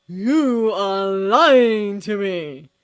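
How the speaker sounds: very loud, slow, booming accusation; vengeful accusation. A narrator's reading. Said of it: "accused with, like, a vengeful god".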